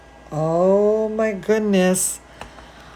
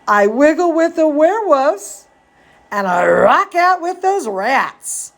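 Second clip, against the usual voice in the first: lively storybook read, a bit higher; reading to children; 6 semitones higher than usual. A narrator's reading. Reading Zambolina to kids, lively storybook voice. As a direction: read it like a bedtime story for little ones. Reading picture book aloud.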